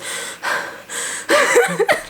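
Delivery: breathless panting with a laugh; out-of-breath panting. Shown as a demonstration. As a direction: gasp for air as if winded from the stairs. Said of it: "I'm literally talking like this".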